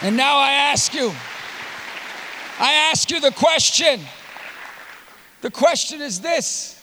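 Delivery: high, ringing, spaced-out phrases; solemn, projected challenge; impassioned altar call. Real, not acted. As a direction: put the question to the whole crowd, pausing between phrases.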